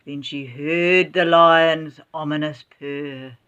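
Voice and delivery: ordinary delivery, nothing marked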